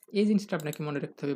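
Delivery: normal talking voice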